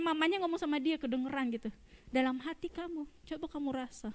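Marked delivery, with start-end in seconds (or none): 2.1-4.1 put-on soft, gentle explaining to a child; tender mother. Mother softly tells her son to feel God in his heart.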